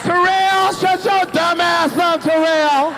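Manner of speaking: hammed-up high yell at a brother; mock angry scolding. Sister scolding her brother in the crowd, mock yell. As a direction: bark it slow like a fed-up sister. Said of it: "She like".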